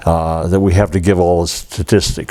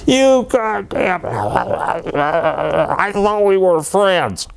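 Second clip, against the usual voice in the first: high, drawn-out mock yell; retold yelling; 10 semitones higher, much slower than usual. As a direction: imitate an angry phone tirade, pitched high without real volume. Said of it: "He's yelling".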